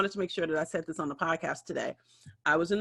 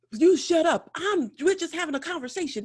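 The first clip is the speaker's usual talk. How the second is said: snapping man; 9 semitones higher, 6 dB louder than usual. Retold or imitated. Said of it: "The dude's like".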